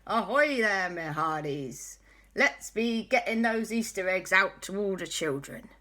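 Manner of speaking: a hint of a pirate, drawn-out ahoy; lightly hammed pirate captain; cat sailor rallying the crew, mild pirate voice; pirate talk. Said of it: "'Cause that's how he talks".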